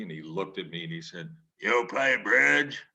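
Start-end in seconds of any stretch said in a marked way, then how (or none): 1.6-2.8 slow, louder gruff editor; drawn-out skeptical boss question; imitating a blunt editor, slowly; Bradlee voice.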